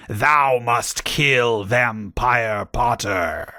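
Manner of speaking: very slow villainous command; Voldemort. A narrator's reading. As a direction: draw each word out ominously. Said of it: "he yelled".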